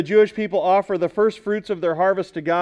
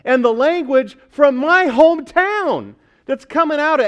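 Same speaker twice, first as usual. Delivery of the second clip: raised, amazed Southern twang; Southern accent; 8 semitones higher, 4 dB louder than usual. Acted. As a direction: marvel in a put-on Southern accent. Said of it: "They're hearing".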